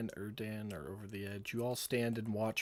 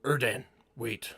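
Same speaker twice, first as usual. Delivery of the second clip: calling out; 3 semitones higher, 7 dB louder than usual. Acted. Willie to Erdan as he leaves the ship, calling out. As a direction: call after a departing friend. Character calls after departing friend.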